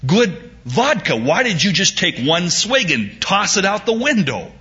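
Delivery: horrified American. Retold or imitated. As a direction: voice the shocked businessman again. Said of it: "he looked horrified".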